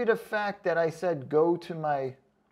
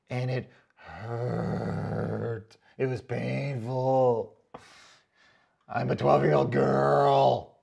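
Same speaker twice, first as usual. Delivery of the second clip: slow, mocking whimper of a hurt girl; mock whiny girl; 4 semitones lower, much slower than usual. Mocking a commenter who found the video painful, whiny girl. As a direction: play up the sulk like a twelve-year-old. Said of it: "that's what it sounds like to me".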